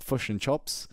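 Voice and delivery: put-on "chops" with the vowel pulled back, New Zealand accent; imitating a New Zealander, New Zealand accent; mock New Zealand accent. Mocking how New Zealanders order food, New Zealand accent. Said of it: "people like".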